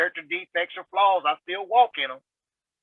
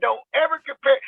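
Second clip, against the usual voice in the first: higher, sharper mock of the annoyed mentor; upset mentor; 9 semitones higher, 4 dB louder, faster than usual. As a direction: replay the mentor's irritation. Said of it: "he got upset with me".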